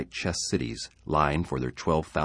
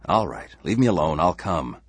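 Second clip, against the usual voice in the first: irritated surrender; 4 semitones higher, 6 dB louder than usual. Acted. Husband gives in irritably.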